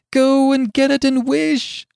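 high, loud, drawn-out command; soaring urgent order; quivering with excitement. A narrator's reading. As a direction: cry it out in frantic excitement. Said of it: "quivering with excitement".

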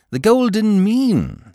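pitched high and slow, mockingly; teasing. A narrator's reading. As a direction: savour the phrase to needle him. Peter teasing Sugg playfully.

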